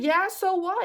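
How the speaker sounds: high, loud "so what" comeback; defensive retort. Retold or imitated. Admitting she cried, high defensive retort. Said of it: "I said".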